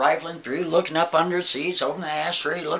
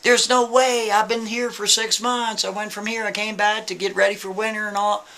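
higher, insistent protest; protesting Kevin; 7 semitones higher than usual. Retold or imitated. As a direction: raise hell from the back seat. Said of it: "still raising hell".

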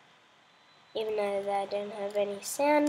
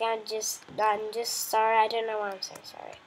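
ordinary delivery

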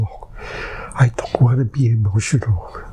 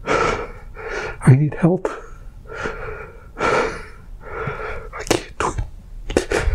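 breathless, slow, fading whisper; whispered plea; 3 semitones higher, 6 dB quieter, much slower than usual. Real, not acted. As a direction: barely get the words out as you beg. Begging for help, crying.

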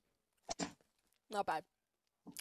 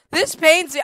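higher, louder anguished repeat; louder pained cry; 5 semitones higher, 7 dB louder than usual. Real, not acted. Suffering through spicy chips.

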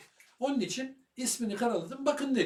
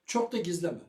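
ordinary talk

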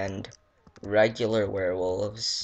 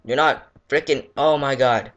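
panicked yell; 4 semitones higher, 8 dB louder than usual. Real, not acted. Panicked outburst.

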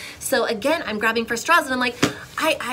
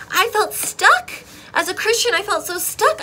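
high, loud, emphatic frustration; exasperated; 11 semitones higher, 6 dB louder than usual. Retold or imitated. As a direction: stress "stuck" in exasperation. Retelling feeling trapped, exasperated.

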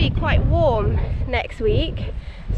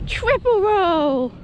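delighted cheer; 6 semitones higher, much slower than usual. Real, not acted. Cheering pony's roll.

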